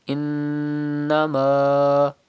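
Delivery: Innama very slow with the doubled n hummed; noon ghunna. Shown as a demonstration. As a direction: hold the nasal nn of Innama. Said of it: "pronounce with gunna".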